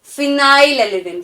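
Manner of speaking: "phenylalanine" drawn out very slowly; very slow model. Shown as a demonstration. Teacher slowly modelling "phenylalanine" for students. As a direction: stretch "phenylalanine" for the class to copy. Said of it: "फ से".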